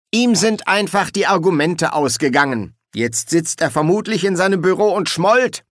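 haughty, high-pitched gloating; self-important, pitched well up; smug. A narrator's reading. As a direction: crow over a beaten opponent. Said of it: "sagte Sol überheblich".

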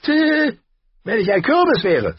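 high, barking and mocking; a sneering high dwarf who laughs at them. A narrator's reading. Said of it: "stieß bellendes Gelächter aus". (hoher, spöttischer Zwergentonfall)

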